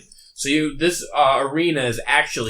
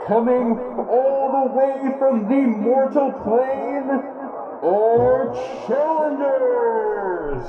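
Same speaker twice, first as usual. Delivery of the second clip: high, drawn-out ringmaster call; arena announcer; 11 semitones higher, slower than usual. Acted. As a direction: announce the challengers to the crowd. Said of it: "you hear a muffled voice in the distance that says".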